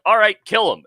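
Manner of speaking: high, loud, offhand order; mock mob boss order. Mob bosses ordering Hoffa killed, loud and offhand. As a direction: bark the kill order casually. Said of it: "they're just like".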